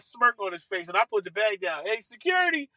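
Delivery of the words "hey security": high-pitched fake report to security; mock calling out. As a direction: call for security, playing it up.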